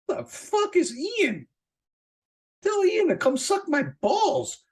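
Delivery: mocking dismissal. Brushing off a threat about Ian, high slow scoffing sneer. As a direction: scoff at the threat like it's a joke. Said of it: "I'd be like".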